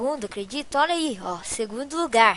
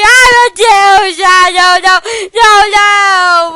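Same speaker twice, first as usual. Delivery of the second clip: loud, higher frantic protest; panicked no; 5 semitones higher, 13 dB louder than usual. Real, not acted. Gamer dying in the game, loud frantic protest. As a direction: cry no as you lose.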